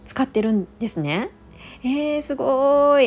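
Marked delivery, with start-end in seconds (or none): 1.8-3.1 drawn-out, higher, louder wow of admiration; impressed amazement. Real, not acted. Host reacting to a listener's all-Muji skincare, impressed amazement. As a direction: react impressed, stretching the word out.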